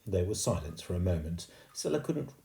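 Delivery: ordinary delivery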